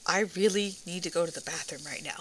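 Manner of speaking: low, hushed imitation of a calm passenger. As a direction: quote him softly, keeping it very composed. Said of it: "he's literally so calmly saying".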